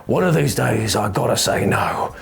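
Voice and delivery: hammed-up despairing shopkeeper, drawn out; slow, put-upon merchant voice; weary merchant. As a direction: groan it out, then go soppy. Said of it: "And he's just, like, sifting through".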